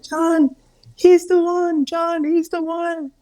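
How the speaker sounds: very high, drawn-out mock amazement; imitated amazed wife. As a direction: imitate a wife urgently telling her husband, high-pitched. Imitating wife recognizing the healer.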